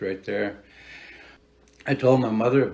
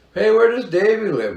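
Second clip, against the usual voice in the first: high, louder call across to someone; 12 semitones higher, 6 dB louder than usual. Retold or imitated. Neighbors calling out asking where Davey lives, high loud call.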